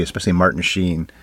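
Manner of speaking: ordinary talk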